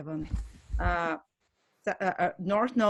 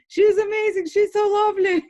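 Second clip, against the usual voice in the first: excited gushing; 13 semitones higher, 10 dB louder than usual. Retold or imitated. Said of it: "They're like".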